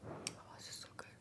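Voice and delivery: breathy whisper over the food. Real, not acted. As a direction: whisper it, savoring the bite. Whispering while eating noodles.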